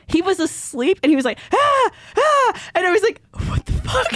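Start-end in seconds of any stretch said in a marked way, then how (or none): 1.5-2.6 high, airy mock screams; imitated sleep screams. Retelling a partner's night terror, high mock screaming. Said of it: "I wake up to him screaming". 3.5-4.1 high-pitched mock shock; retold startled reaction, pitched way up; startled disbelief. Waking to a partner screaming, startled disbelief.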